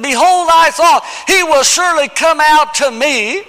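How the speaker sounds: high, loud, offended cry; indignant shout. Acted. Naaman's angry complaint at the prophet, shouted. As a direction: shout it like a man insulted. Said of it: "this is what he said".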